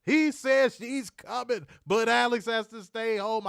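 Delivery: high, loud and slow mock announcement; teasing holler. Host ribbing co-host over Piper, teasing holler. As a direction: proclaim it slowly at full voice.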